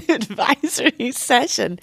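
words squeezed out through laughter; laughing. Real, not acted.

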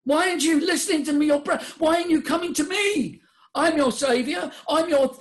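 high, hammed-up scolding; exasperated pleading nature. Breath begs the person to listen and come, high exasperated pleading. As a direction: plead and berate at a high pitch. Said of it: "science says".

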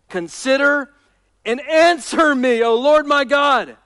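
slow, ringing cry to God; pleading psalm reading. Acted. Preacher reading David's prayer to the Lord, slow and imploring. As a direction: draw out each phrase of the plea.

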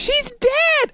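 very high exclamation of disbelief; high-pitched astonished outburst; incredulous squeal. Real, not acted. Repeats incredulous objection.

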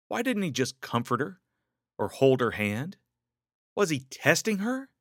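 ordinary delivery

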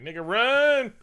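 high, loud mock alarm; panicked yell, hammed up; shouted warning. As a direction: yell it out, stretching the word run. Vision warns the others to run.

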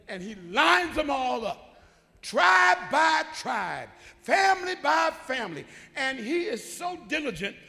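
loud, high, stretched-out words; full-force preaching. Real, not acted. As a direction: build to a slow shouted climax.

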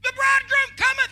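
high, loud prophetic cry; preacher's cry. Retold or imitated. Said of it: "He preaches".

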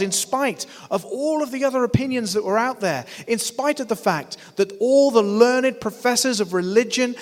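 high and loud, building preacher's cry; impassioned preaching. Acted. Pastor rousing the congregation about Peter, in a loud preaching cry. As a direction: preach it out over the crowd.

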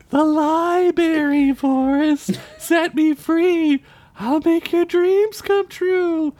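high, slow, eerie spirit voice; ghostly voice. Acted. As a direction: beckon him like a disembodied spirit. Disembodied voice speaks in story.

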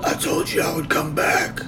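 quiet gravelly growl. Retold or imitated. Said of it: "All gravelly and stuff".

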